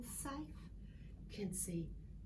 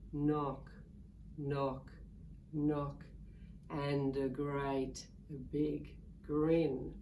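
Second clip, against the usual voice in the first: much lower, slow ominous voice; menacing low knock; 8 semitones lower, much slower than usual. A narrator's reading. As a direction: sound sinister. Snake arrives menacingly.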